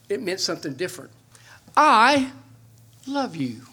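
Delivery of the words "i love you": "I" punched high and loud, "love you" dropping away; stress on I. Shown as a demonstration. Preacher recalls speech-class lesson, stressing "I". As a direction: hit "I" hard, pause, then ease off. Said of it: "emphasize each word differently".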